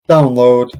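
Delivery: usual speaking voice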